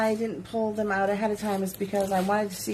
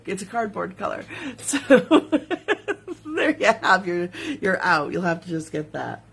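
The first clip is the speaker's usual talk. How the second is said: ordinary talk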